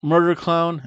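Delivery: normal talking voice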